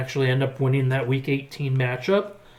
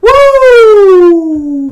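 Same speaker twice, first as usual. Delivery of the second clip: excited whoop; 12 dB louder than usual. Real, not acted. Celebrating Mingo.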